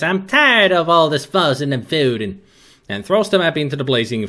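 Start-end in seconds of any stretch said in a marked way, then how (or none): 0.0-2.4 higher, louder fed-up matriarch; acted high exasperation; Miss Ellie. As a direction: scold the family in a put-on voice. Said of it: "She grabs the map and says".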